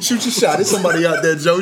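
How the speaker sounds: teasing through laughter; laughing. Real, not acted. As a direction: laugh while egging him on. Laughing while teasing friend.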